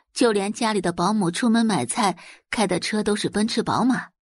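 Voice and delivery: ordinary delivery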